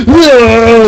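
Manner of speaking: loud high delighted whoa; excited whoa. Retold or imitated. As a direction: burst out in thrilled amazement. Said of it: "and you're like".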